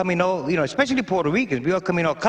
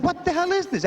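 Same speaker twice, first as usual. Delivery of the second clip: shocked outburst; 10 semitones higher than usual. Retold or imitated. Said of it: "I said".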